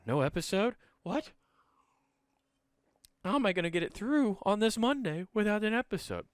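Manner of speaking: high mock-whiny listener voice; imagined worried listener. Host voicing a fan upset about no episode, high and whiny. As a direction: whine like a fan missing the show.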